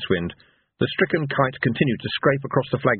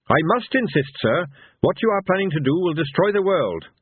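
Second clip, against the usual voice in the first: higher, insistent officer's voice; Captain Carrot; 8 semitones higher than usual. A narrator's reading. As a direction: warn them formally, pitched up. Carrot warns the heroes.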